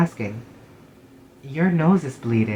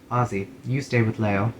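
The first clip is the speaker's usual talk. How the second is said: normal talking voice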